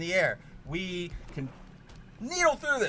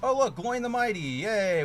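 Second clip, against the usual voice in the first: normal talking voice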